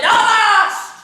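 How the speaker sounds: high, loud pirate cry; shouted Avast. A narrator's reading. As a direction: bark it from the deck.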